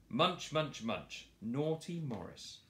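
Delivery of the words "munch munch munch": munching. A narrator's reading. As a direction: chew it out greedily. Boar eating noise.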